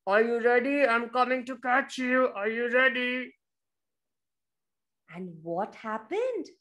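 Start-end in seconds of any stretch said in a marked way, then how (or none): 0.1-3.3 bear calling. Acted. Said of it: "the bear is shutting his eyes and saying".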